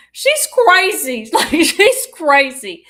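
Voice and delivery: slow, loud fond exclaim; drawn-out fond exclamation, half laughing; she's crazy. Real, not acted. Telling the host about Belinda, slow and loud.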